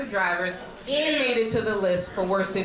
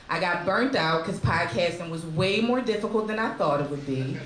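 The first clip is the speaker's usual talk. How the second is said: usual speaking voice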